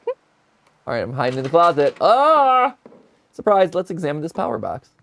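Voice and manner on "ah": sudden high yelp of fright; startled yelp. Real, not acted. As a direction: jolt out a surprised cry. Jump-scare while hiding in closet.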